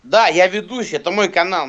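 ordinary talk, nothing marked

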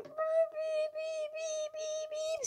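very high beep beep like a machine; mock beeping. Gamer imitating the game's beeping system, very high pitched.

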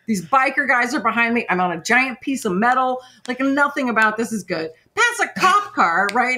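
usual speaking voice

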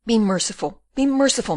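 anxious, insistent plea; pleading. Acted. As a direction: beg him desperately to relent. Rosa begs the raging prisoner.

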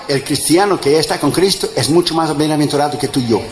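ordinary talk, nothing marked

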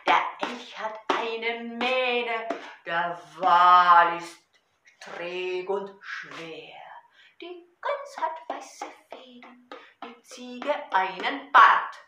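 loud rhythmic poem recital over a drum; Gedichtvortrag. Shown as a demonstration. (laut und rhythmisch zur Trommel vorgetragen)

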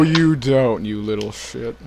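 ordinary talk, nothing marked

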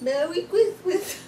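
saying it with a mouthful of candy; cheeks stuffed full. Real, not acted. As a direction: talk through a mouthful of chocolate. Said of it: "With my mouthful of M and M's".

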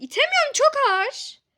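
high, loud strained puppet voice; Limon's strained voice. Acted. Limon the toy dog strains at the heavy door, high loud voice. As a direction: strain as if the door won't budge. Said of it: "bağırıp durma [name]".

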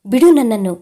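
loud angry push-away cry; furious, loud let go of me; angry shout. A narrator's reading. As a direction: shout it, shoving him off. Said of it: "ಕೋಪದಿಂದ ಕೈಗಳಿಂದ ತಳ್ಳುತ್ತಾ".

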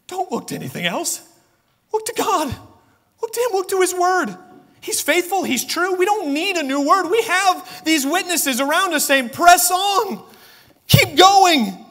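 high, rising preacher's urging; heavenly witnesses shouting encouragement; rousing exhortation. Acted. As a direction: cheer the runners on toward the finish. Witnesses voiced urging the listeners.

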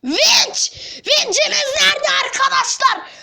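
high panicked yell; alarmed shouting. Acted. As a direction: raise the alarm to everyone. Crane missing, alarm.

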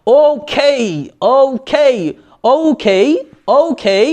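British "ওকে", drawn ou glide and puffed khei; British-style okay. Shown as a demonstration. Teacher showing how the British say it, British accent. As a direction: stretch both halves in a British manner. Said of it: "এরকম বলে".

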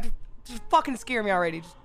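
usual speaking voice

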